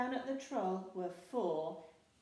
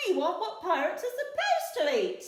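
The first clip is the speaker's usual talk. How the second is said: much higher, loud laughing demand; laughing pirate; 11 semitones higher, 14 dB louder than usual. A narrator's reading. Pirates demanding proper food, laughing. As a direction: laugh while insisting.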